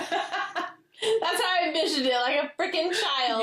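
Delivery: laughing high through the words; giggling at her own picture of it; amused high laugh. Real, not acted. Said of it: "laughing while explaining".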